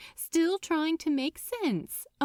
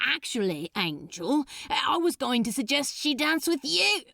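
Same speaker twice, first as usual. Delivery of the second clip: Crowley's voice; 4 semitones higher, faster than usual. Acted. Crowley deflects to Aziraphale.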